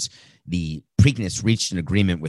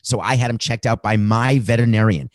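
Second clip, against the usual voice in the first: ordinary talk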